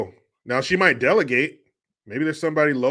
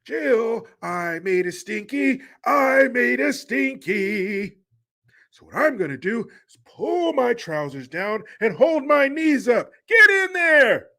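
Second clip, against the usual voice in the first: high, drawn-out whiny holler; mock pleading in a high, put-on voice; hammed-up helpless patient; 10 semitones higher, 4 dB louder, slower than usual. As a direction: whine loudly like a big helpless baby. Plays patient needing Jill to clean him.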